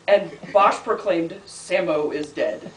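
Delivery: slow, deliberate closing line; poetry reading. Acted. Poet ending a poem to an audience's laughter, slow recital. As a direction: draw out the poem's ending, word by word.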